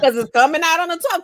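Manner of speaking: high, loud, rushed through laughter; laughing panic. Retold or imitated. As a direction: blurt it out fast while cracking up. Joking about self-imposed podcast deadline.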